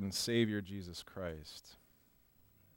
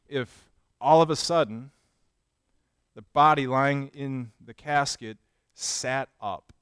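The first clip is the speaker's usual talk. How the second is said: ordinary talk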